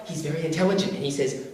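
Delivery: ordinary delivery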